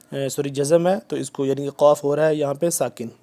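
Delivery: ordinary delivery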